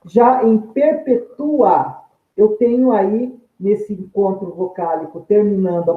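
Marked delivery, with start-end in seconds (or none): 0.6-2.0 "perpetua" said very slowly, stress on TU, the "u" and "a" split; teacher slowly saying "perpetua" as per-pe-TU-a, with a break before the "a"; slow demo word. Shown as a demonstration. Teacher to students, slowly showing "perpetua" with a break in the "ua". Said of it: "Tenho dois impulsos para falar esse A".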